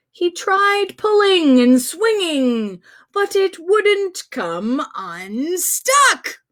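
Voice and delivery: slow, drawn-out storybook narration; story reading. Acted. Host reading Stuck aloud to children, slow and drawn out. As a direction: read it slowly to hook the kids.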